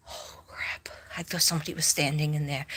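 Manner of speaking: hushed, quick startled whisper. Real, not acted. Alone at night, house asleep, startled whisper. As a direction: whisper fast, spooked in the dark.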